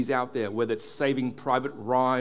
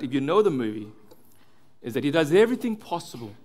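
normal talking voice

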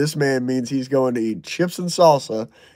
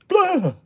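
mock disgust blurt. Dismissing Cracker Barrel.

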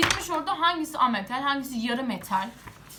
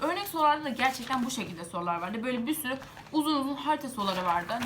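usual speaking voice

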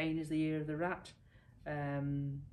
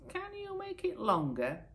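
fretful minister. Acted. Minister with bare legs.

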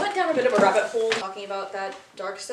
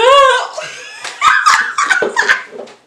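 high, shrieking burst of laughter; 11 dB louder than usual. Real, not acted. Loud laugh.

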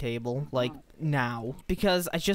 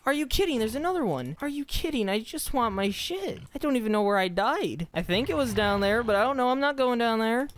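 high frantic pleading; rising panic, voice pitched up; panicked; 8 semitones higher than usual. Real, not acted. Gamer at one heart fleeing mobs, high panicked whine.

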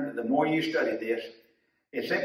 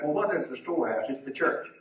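usual speaking voice

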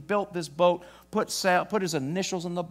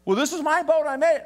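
put-on boy's protest; 8 semitones higher, 4 dB louder, faster than usual. Boy protests to store owner.

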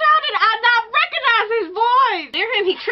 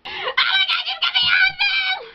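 excited shout; 10 semitones higher than usual. Real, not acted. Delighted reaction.

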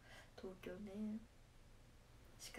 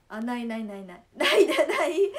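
laughing denial; 16 dB louder than usual. Real, not acted. Streamer rejecting forgetting to eat, laughing loudly. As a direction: deny it through a big burst of laughter.

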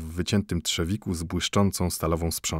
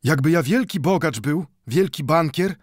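Mendel, higher and louder, eager; 8 semitones higher, 4 dB louder than usual. A narrator's reading. Mendel eagerly answers the watchmaker, higher. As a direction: praise the wise word eagerly.

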